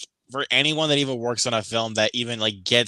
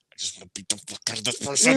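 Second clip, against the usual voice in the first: fast, softer seething threat; mock muttered rage; 3 semitones lower, 5 dB quieter, faster than usual. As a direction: grumble it under your breath.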